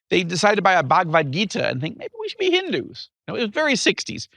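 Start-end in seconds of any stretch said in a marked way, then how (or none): 2.0-3.1 mock musing. Parents musing in the sixties.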